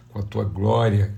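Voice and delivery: ordinary talk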